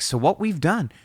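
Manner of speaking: high, eager pitch-man lead-in; pumped producer. Retold or imitated. Retelling the producer's big sales pitch, pumped. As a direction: lightly mimic an excited record man launching his pitch. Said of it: "he's so pumped".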